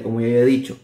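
normal talking voice

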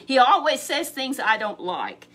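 loud sulky complaint; complaining king. Acted. Ahab grumbling to Jehoshaphat, loud and sulky. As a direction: whine it out loud like a spoiled king.

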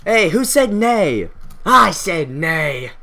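loud, drawn-out challenge; mock-dramatic standoff; acted confrontation.

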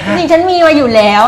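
laughing loudly while joking. Real, not acted. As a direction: burst out laughing mid-line. Playful joke between hosts.

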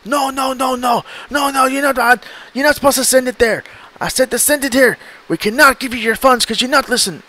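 furious Nigerian scammer scolding; Nigerian-accented scammer yelling he isn't listening; Nigerian scammer losing it. Retold or imitated. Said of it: "the scammer just loses it".

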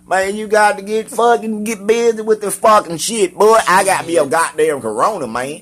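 high, loud country bragging; pitched-way-up country rant; exaggerated country voice. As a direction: holler it like an over-the-top country boy. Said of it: "I can sound as country as I fucking wanna".

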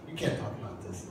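a hushed whisper of alarm; whispered warning. Retold or imitated. Men in the audience warning Keith to stop, whispering. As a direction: whisper it like the men might get caught. Men in room hushing speaker.

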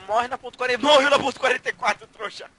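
loud triumphant callout; excited gloating. Real, not acted. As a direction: yell it out, thrilled with the kill. Gloating over a kill.

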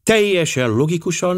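"Teljesen" lifted high in a mocking echo; ironic echo. Poirot echoing Miss Limon to mock the logic, ironic. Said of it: "mármint egy orosz számára".

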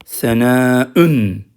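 Senâ'ün, slow, ending on ün; tenvin ending. Shown as a demonstration. Teacher showing students the form without el, slow. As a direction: stretch it out, finish with ün. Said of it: "Bakın ün diyeceksiniz".

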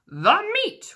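high, loud rowdy roar; crew roar. A narrator's reading. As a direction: roar it like hungry pirates. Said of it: "we all roared".

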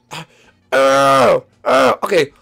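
high, loud birth-coach urging; hammed-up delivery room shouting; urgent coaching shout. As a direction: cheer them through a push.

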